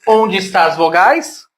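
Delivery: normal talking voice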